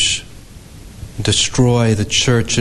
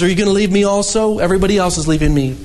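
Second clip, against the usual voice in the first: hurried plea; 6 semitones higher, much faster than usual. Acted. Jesus asking disciples.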